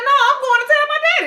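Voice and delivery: put-on high, fast childish threat; high-pitched kid whine, quick and defiant; little girl tattling. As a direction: squeak it out like a seven-year-old about to tell on you. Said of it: "And she said".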